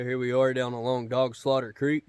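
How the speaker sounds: ordinary delivery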